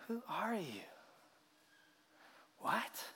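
hushed, breathless whisper of disbelief; a disciple whispering in awe to the others; awed whisper. Retold or imitated. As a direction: whisper it as if stunned by a miracle.

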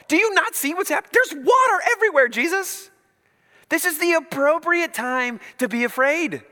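panicked disciple. Retold or imitated. Frightened disciple yelling at Jesus in the storm, high panicked shout. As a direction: yell it like you think you're about to drown. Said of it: "That's what I would feel".

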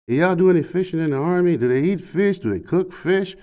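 high, excited, rambling questions; high eager drunk. Retold or imitated. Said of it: "he was still talking to me through the door".

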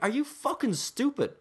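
high, slow incredulous insult; exasperated disbelief. Retold or imitated. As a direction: drag out the disbelief. Retold exasperated outburst at customer.

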